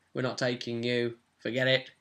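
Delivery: ordinary talk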